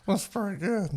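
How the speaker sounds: first wine taster. Retold or imitated.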